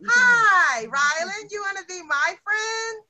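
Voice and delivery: high, loud, friendly hi; smiling greeting. Shown as a demonstration. Teacher showing pupils how to make friends, smiling friendly greeting. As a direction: greet a new playmate with a big smile. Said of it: "If you smile".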